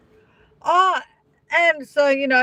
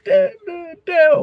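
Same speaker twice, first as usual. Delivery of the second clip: hammed-up blubbering syllables; mock sobbing; 7 semitones higher than usual. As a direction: imitate a crying guest walking off. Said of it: "start crying".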